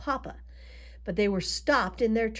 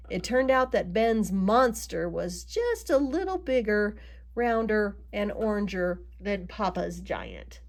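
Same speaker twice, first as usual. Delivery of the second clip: plain reading voice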